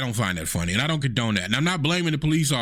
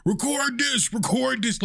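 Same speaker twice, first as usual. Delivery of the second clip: high, soft mock yell of record this; a light mock scream; mock demand to record; 11 semitones higher, 6 dB quieter than usual. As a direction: imitate a panicked demand to film. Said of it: "they're screaming".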